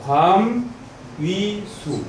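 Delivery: high, separated syllables with pauses. Shown as a demonstration. Teacher correcting the reading for students, syllable by syllable. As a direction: say each syllable on its own. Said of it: "수수가 아니라 뭐예요?".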